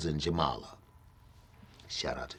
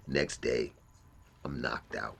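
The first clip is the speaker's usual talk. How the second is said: ordinary talk, nothing marked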